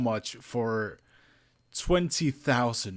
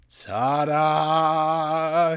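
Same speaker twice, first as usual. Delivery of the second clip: big reveal; 3 semitones higher, 9 dB louder than usual. Real, not acted. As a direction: present it like a magic trick. Unveiling the statue.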